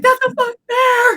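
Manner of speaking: high, loud terrified cry; panicked yell. Retold or imitated. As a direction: scream it as the bear comes at you. Realizing a bear approaches.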